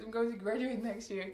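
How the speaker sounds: usual speaking voice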